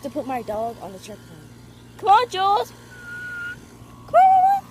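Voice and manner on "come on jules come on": very high, loud coaxing. Real, not acted. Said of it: "I talk as a baby talk".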